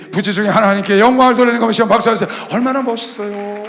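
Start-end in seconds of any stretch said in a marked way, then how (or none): none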